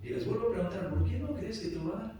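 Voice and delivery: usual speaking voice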